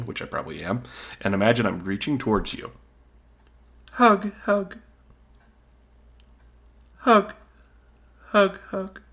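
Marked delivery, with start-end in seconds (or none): 3.9-9.0 high, loud pleading hug demo; needy hug plea. Shown as a demonstration. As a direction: beg for hugs like a clingy toddler. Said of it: "let's practice right now".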